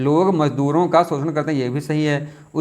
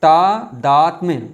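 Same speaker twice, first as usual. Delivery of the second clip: तादात्म्य stretched out slowly, every syllable clear; teacher spelling out तादात्म्य slowly; slow careful word; 4 dB louder, much slower than usual. Shown as a demonstration. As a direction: draw out तादात्म्य slowly for the class. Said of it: "ठीक है, तो".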